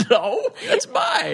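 squealing laugh through a denial; high, slow, breaking into laughter; laughing hard. Real, not acted. Denying a silly mantra is borrowed, laughing hard.

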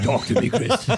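lightly voiced deep-actor impression; James Earl Jones. Repeating the voice given to Michelle Williams, mock deep. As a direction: put on a deep, weighty star voice. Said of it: "a deep James Earl Jones voice".

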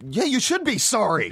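very high-pitched comic outrage; mock indignation. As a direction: play an entitled actor demanding apologies. Voicing a self-important actor.

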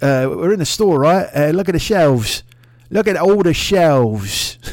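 loud, slow dazed Brit; mock English complaint. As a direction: show off the empty shelves in disbelief. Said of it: "everybody's like".

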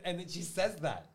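usual speaking voice

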